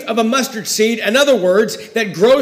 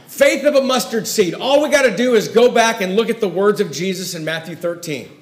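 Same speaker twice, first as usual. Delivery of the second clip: ordinary talk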